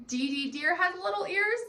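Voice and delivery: normal talking voice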